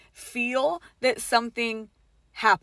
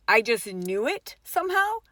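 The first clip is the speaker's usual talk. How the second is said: plain reading voice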